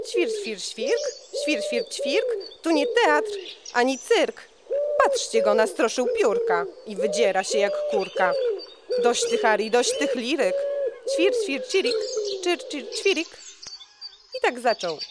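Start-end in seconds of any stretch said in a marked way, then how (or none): 0.1-4.5 high chirpy sparrow twitter; sparrow chirping. A narrator's reading. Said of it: "wróbel zaterlikał". 9.0-13.3 high chirpy sparrow twitter; sparrow chirping. A narrator's reading. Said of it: "wróbel zaterlikał".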